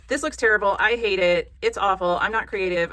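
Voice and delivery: loud hammed-up self-disgust; loud, exasperated mock outburst; mock frustration. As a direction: play a beginner loudly giving up. Acts out frustrated beginner giving up.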